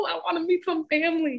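high-pitched mock enthusiasm; eager boyfriend. Winston asking Stella to meet her family, mock eager. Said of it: "He's like".

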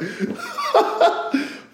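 high, breathy run of laughter. Real, not acted. Speaker cracking up over overthinking, high laugh. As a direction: giggle helplessly at yourself.

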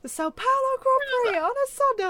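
imitating a race commentator announcing the win; commentator call. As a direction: play the commentator announcing the winner.

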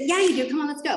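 high, eager pep-talk voice; retold rallying push, pitched well up; encouraging urging. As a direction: imitate a keen friend talking someone into it. Retold friend urging her on.

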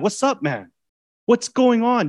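high, easy friendly greeting; God voiced as a casual friend; casual buddy. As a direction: greet him like an old friend. God greeting casually, pitch jumps.

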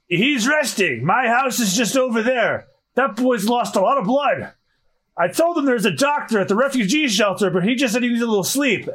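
put-on high-pitched old-timer voice; high, elderly character voice; old mayor explaining. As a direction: explain things like a fussy village elder.

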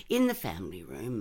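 ordinary delivery

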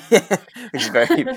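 words spilling out through a real laugh; amused giggle breaking into the words. Real, not acted. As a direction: laugh as you start the sentence. Amused reaction to a sweet scene.

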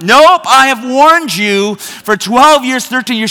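high, emphatic dad scolding; exasperated refusal. Retold or imitated. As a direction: re-enact a fed-up father refusing to step in. Dad refusing screaming daughter's plea.

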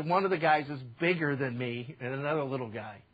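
normal talking voice

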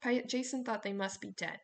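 ordinary delivery, nothing marked

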